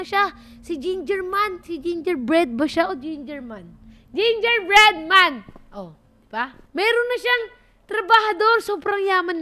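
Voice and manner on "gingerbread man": slow loud announcement of the name; calling out. Real, not acted. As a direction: shout the name slowly.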